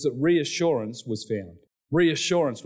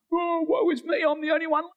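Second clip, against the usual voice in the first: high-pitched whine of despair; self-pitying wail. Acted. Preacher acting Elijah moaning to God that he is alone, self-pitying wail. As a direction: wail it like a hammed-up martyr.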